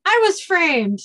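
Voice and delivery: high, loud comic protest; protesting dog. Acted. Kennel dog protesting to Dean, mock outrage.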